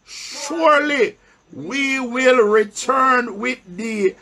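solemn pulpit Bible reading; scripture reading. Acted. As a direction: read the verse slowly to the church, pausing for answers. Preacher reads scripture aloud.

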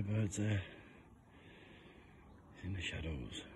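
hushed golf commentary voice; soft, quiet TV golf announcer; golf commentator. Pretend.

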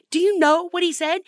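emphatic repeat. Acted. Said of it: "And do you know what he said?".

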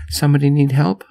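high, searching call; mild narrated yell. A narrator's reading. Rider calling into the dark storm, mild high yell. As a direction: raise the pitch as if calling out. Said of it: "I yelled".

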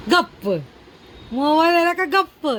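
punchy mock-surprised teasing; playful teasing. Real, not acted. Teasing baby who fell silent.

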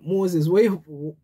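lightly voiced Jamaican patois grumble. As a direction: call out to Moses in Jamaican patois. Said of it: "I'm gonna say in Jamaican".

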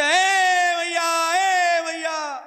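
high drawn-out shouted call; shouting. Acted. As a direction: yell it long from the door. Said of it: "चिल्लाते".

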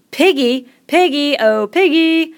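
high, loud mocking chant; gleeful crowd jeer; jeering cry. A narrator's reading. Boys jeer at the nickname.

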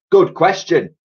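plain reading voice

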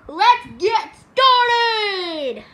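slow, high, loud show opener; drawn-out host call. Acted. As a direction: stretch it out like a game show host.

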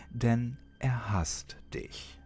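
ordinary delivery, nothing marked

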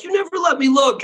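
a jump way up into a mock Gomer complaint; Gomer whining. Cohost quoting Gomer's complaint, high mock whine. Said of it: "When Gomer's like".

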